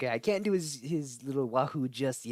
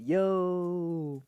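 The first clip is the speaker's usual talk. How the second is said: mock Luigi; 5 semitones higher, 4 dB louder than usual. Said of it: "A plus Luigi impression".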